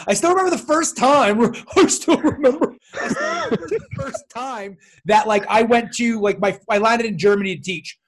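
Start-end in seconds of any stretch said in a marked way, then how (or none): 0.1-4.8 put-on high, creaky old-timer bit, laughing; hammed-up old man reminiscing; mock old man. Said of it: "I sound like such an old man".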